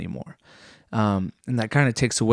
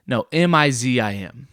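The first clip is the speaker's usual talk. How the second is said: ordinary delivery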